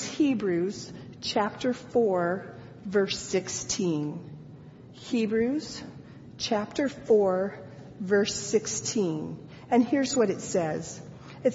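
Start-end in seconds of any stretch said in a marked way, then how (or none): 0.0-4.2 slow emphatic reference. Shown as a demonstration. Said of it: "use the nine one one verse". 4.9-9.4 Hebrews chapter four said slowly, word by word; slow, deliberate reference; slow repeat. Shown as a demonstration. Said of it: "use the nine one one verse".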